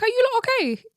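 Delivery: high baffled question; incredulous. Retold or imitated. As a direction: ask it like you can't believe your eyes. Baffled aside at cult crowd.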